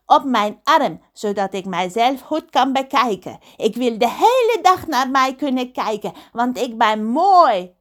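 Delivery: vain pirate in a put-on voice; hammed-up self-admiring pirate; Pedro de Wonderpiraat. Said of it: "belde Pedro. Hij wilde een tatoeage".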